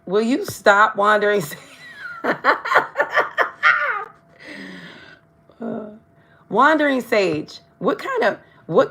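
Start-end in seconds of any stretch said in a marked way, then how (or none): 2.0-4.0 sudden high burst of laughter; amused high laugh. Real, not acted. Laughs at a viewer's comment.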